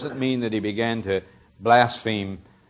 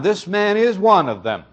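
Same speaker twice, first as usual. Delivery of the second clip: higher, a bit louder, pointed accusation; accusing maid; 9 semitones higher, 4 dB louder than usual. Retold or imitated. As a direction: point him out to the crowd. Maid accuses Peter to bystanders.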